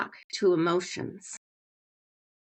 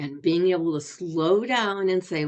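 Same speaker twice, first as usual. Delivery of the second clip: normal talking voice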